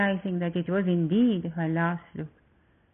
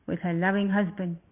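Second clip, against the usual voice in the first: plain reading voice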